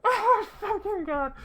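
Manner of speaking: high, slow cackle of disbelief; cackling. Real, not acted. Streamer cackling at goofy armor. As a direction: laugh out the curse. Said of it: "makes me fucking cackle".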